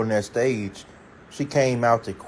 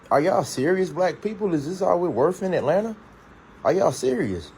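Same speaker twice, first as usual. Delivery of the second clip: exasperated disbelief; 6 semitones higher than usual. Real, not acted. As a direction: pitch jumps higher, incredulous and pleading.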